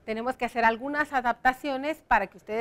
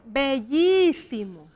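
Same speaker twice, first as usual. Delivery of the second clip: "bellísimo" slowly, with a long stressed "llí"; much slower than usual. Shown as a demonstration. Teacher showing where the accent goes, "bellísimo" stretched on the í. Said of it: "tiene acento en la I".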